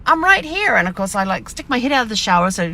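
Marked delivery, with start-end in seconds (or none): 0.0-0.7 much higher pet voice, reassuring; reassuring puppy. Retold or imitated. Owner calling from shower to crated puppy, high reassuring. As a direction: let her know you're right there.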